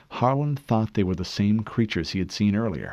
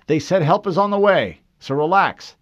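corporal to his men; 10 semitones higher, 8 dB louder than usual. A narrator's reading. Corporal reassuring men in bunker.

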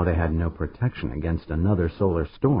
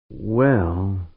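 drawn-out well. Acted. Pancho stalling before admitting the risk, a drawn-out "Well". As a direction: stretch the "Well" out long. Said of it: "she drew the word out".

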